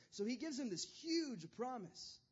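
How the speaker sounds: normal talking voice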